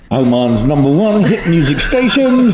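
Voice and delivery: high, laughing mic announcer voice; train announcer voice. Pretend. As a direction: announce it like a station tannoy. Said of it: "talking like a train announcer".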